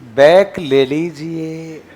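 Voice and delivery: slow, gentle "back ले लीजिए"; polite request. Shown as a demonstration. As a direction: ask kindly and unhurried. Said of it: "प्यार से".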